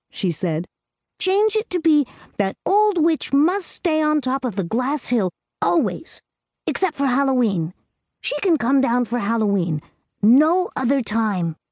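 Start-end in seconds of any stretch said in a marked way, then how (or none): 1.2-11.6 put-on higher, earnest little girl voice; a small girl laying down the rules; little girl Amy. A narrator's reading. Amy telling Clarissa the witch's fate, in a higher girlish voice.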